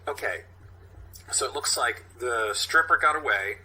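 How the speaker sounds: usual speaking voice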